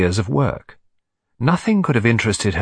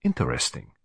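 put-on low, soft accented reflection; Hercule Poirot; 10 semitones lower, 8 dB quieter than usual. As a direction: murmur it to yourself as the detective. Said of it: "said Poirot".

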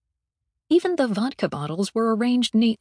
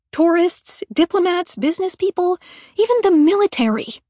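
fast, climbing higher and louder; building outrage; 5 semitones higher, 6 dB louder than usual. Acted. Mallory listing who is coming aboard, mounting outrage. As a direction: pile the list up toward a peak.

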